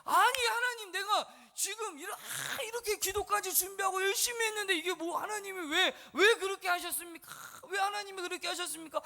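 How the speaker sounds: acted high, indignant plea to God; startled, aggrieved questioning; shocked protest. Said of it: "깜짝 놀란 거예요".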